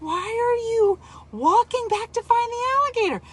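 high exasperated question; exasperated disbelief. Retold or imitated.

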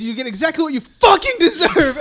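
mock snarl. Retelling the Joker's last line, loud mock snarl. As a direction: spit the line out half-laughing. Joker punchline retold with force.

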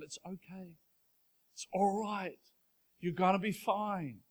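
soft, gentle, reassuring; a parent calming a crying child, soft and steady. Retold or imitated. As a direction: hush a child in a crisis. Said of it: "the calming voice of the parent".

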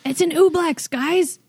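high, loud gleeful announcement; excited. Real, not acted. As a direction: blurt it out with delight. Excited reveal to the party.

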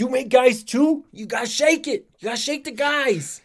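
over-the-top yelly character voice; yelly impression. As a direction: bellow it like a cartoon neighbor. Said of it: "horrible yelly impressions at you".

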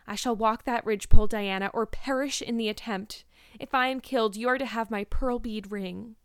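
grave, emphatic vow; solemn. A narrator's reading. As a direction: declare it with solemn resolve. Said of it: "said Anne solemnly".

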